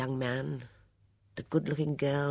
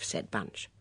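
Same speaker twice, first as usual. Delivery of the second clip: ordinary delivery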